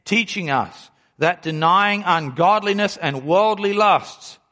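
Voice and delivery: usual speaking voice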